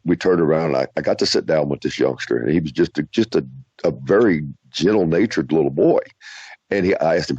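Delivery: ordinary talk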